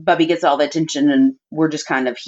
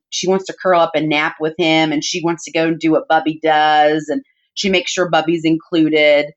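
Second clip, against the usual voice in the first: usual speaking voice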